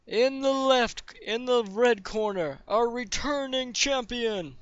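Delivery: high, drawn-out fight announcer voice; arena announcer. Acted. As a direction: introduce the fighters to a roaring crowd. Game master plays arena announcer.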